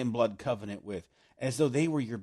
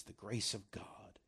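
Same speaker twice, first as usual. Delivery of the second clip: whispered; 5 semitones lower, 14 dB quieter, slower than usual. Real, not acted. Hushed aside on God's grace.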